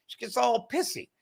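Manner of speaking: normal talking voice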